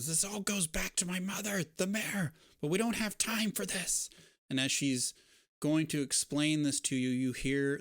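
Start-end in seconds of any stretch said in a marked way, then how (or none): 0.1-4.1 put-on a somewhat higher hurried young woman; lightly voiced urgent girl. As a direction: speak up higher as the freed girl. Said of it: "she rips the thing off and she says".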